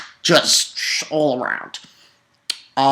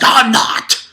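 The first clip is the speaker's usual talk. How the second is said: defiant yell; 7 semitones higher, 5 dB louder than usual. Retold or imitated. Acted defiant reply in recap.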